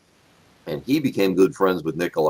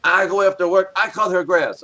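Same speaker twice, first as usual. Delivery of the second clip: raised, emphatic imitated voice; Nikolai; 7 semitones higher than usual. Inspector refuses to ticket the old lady, imitated higher voice. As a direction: quote the big-hearted inspector in a higher voice. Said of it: "he said".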